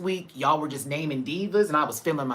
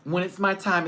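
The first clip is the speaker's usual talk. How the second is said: usual speaking voice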